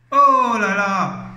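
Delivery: slow, drawn-out awed oh là là; awed groan. Real, not acted. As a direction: groan at the thought of hell. Stupeur devant l'enfer. (long soupir stupéfait)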